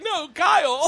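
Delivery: high, stretched imitation of Australian "Carl" as "Kyle"; hammed-up high Australian insistence; mock Australian correction. Teasing the Australian correcting Americans, mock Australian protest. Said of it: "And you're like".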